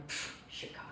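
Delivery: whispered, scoffing Pfft, Chicago; soft mocking scoff to yourself; dismissive scoff. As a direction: brush it off under your breath. Dismissing the prediction after leaving.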